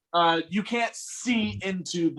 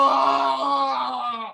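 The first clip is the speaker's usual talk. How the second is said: high, drawn-out cry of pain; long, loud groan of pain; 6 semitones higher, 4 dB louder than usual. Acted. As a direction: wail as if waking up to agony. Revived wounded man in pain.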